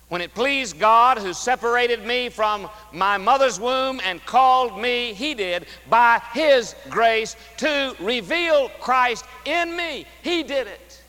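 slow, loud, ringing pulpit voice; forceful preaching. Acted. Preacher proclaiming scripture to the congregation, full force. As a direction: preach it out to the back pews.

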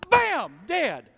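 loud, high mock bam; loud punchline. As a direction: slam the punchline with a shout. Sudden death punchline.

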